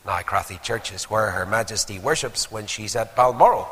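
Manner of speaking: ordinary talk, nothing marked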